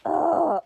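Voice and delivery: loud exasperated ugh; frustrated groan. Real, not acted. Reader fed up with a character, loud groan. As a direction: groan out your frustration at the book.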